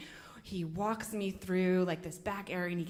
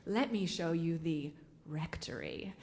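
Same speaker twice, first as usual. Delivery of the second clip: low, softer whispered invitation; suggestive whisper; 4 semitones lower, 5 dB quieter than usual. Retold or imitated. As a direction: whisper it like a secret offer. Priest invites her to the rectory.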